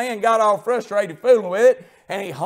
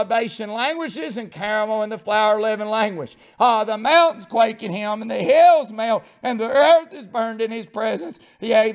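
normal talking voice

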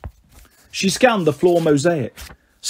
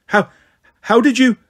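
higher, louder startled stammer; stunned stammer; 6 semitones higher, 5 dB louder than usual. Acted. Stunned ghost priest stammers at Annabeth, startled. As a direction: stumble over it in shock.

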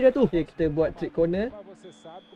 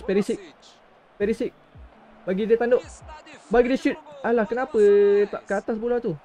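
normal talking voice

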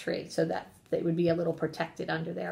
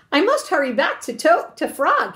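raised, louder storybook cry; higher-pitched anxious exclamation; urgent cry; 7 semitones higher, 7 dB louder than usual. A narrator's reading. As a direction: cry it out as a hurried Toad. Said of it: "he cried".

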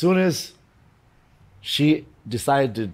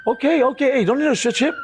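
much higher-pitched retold reply; acted placating; 14 semitones higher than usual. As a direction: wave off trouble in a high, hurried voice. Retelling his reply in the confrontation.

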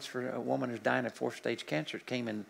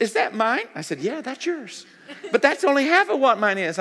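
excited exchange; 10 semitones higher, 7 dB louder than usual. Retold or imitated. Woman crying over her reading.

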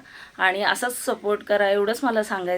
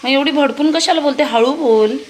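raised voice; 5 semitones higher, 7 dB louder than usual. Real, not acted. Mother scolds daughter loudly.

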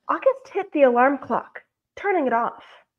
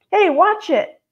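raised, louder protest; indignant call; 6 semitones higher, 5 dB louder, slower than usual. A narrator's reading. August shoved over by teenagers, indignant call. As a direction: call sharply after careless passers-by.

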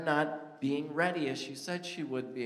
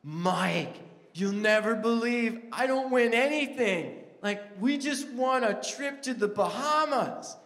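high, louder, breathless with joy; excited; 6 semitones higher, 4 dB louder than usual. Retold or imitated. Wife running to her husband with the news, excited. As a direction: gush about the prize. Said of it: "she's running to me".

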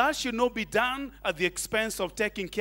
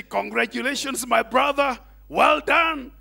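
mock congratulations; 4 semitones higher, 6 dB louder than usual. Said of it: "But to say".

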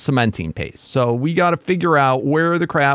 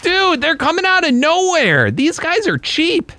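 being chased; 11 semitones higher than usual. Real, not acted. Chased by spawning tribesmen.

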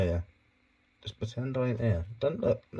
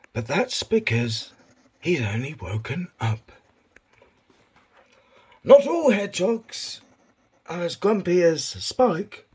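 higher, mock nature-documentary narrator voice; David Attenborough; 3 semitones higher than usual. Owner filming his hedgehog for viewers, mock Attenborough narration. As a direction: narrate the hedgehog like a wildlife film presenter. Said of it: "I've lost the David Attenborough".

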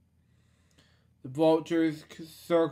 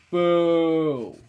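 boo; 2 semitones higher, 8 dB louder than usual. Acted. Crowd of hyenas cheering.

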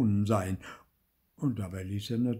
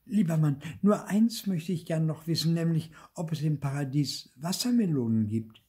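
raised, wheedling wife; the wife pesters her husband again, raised voice; wife pestering; 6 semitones higher, 4 dB louder than usual. A narrator's reading. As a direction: start nagging him once more. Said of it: "fing sie wieder an".

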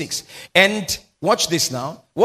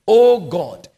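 loud, fervent cry; crying out to God; 3 semitones higher, 9 dB louder than usual. Retold or imitated. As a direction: call out to God with force. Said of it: "he says".

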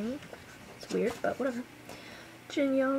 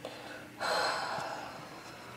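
drawn-out sigh of fangirl defeat; long overwhelmed sigh; 7 dB quieter than usual. Real, not acted. Fan overwhelmed by the photos, long sigh. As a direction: let out a long helpless sigh.

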